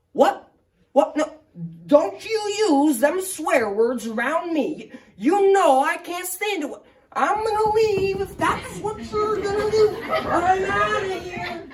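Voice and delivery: put-on high, cranky old-timer scolding; old man Ed fussing at the driver about swearing; crotchety old man. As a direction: play a huffy old coot threatening to leave.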